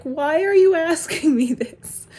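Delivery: high, loud, slow protest; exasperated comeback. Retold or imitated. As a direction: drag the question out in exasperation. Exasperated comeback to pestering texts.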